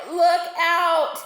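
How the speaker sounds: high, loud warning shout; crying out a warning. A narrator's reading. Stubby warns friends of the snapping shark, urgent shout. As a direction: yell to warn the others of the shark. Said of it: "As Stubby cried".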